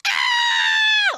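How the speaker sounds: very high, loud squeal; excited scream. Retold or imitated. Woman alone after closing the door, screaming. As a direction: let out an imitated scream of excitement. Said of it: "Like, screaming".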